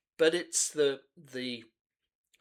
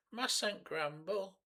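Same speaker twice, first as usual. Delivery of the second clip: slow, higher mock Cockney shrug of a line; mock Cockney accent; 10 semitones higher, 4 dB quieter, slower than usual. Quoting the lyric to viewers in mock Cockney accent. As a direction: drop into a hammed-up Cockney grumble. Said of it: "a mock Cockney accent".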